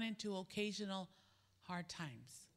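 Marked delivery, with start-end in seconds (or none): none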